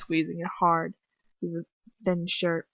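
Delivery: ordinary delivery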